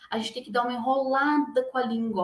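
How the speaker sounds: normal talking voice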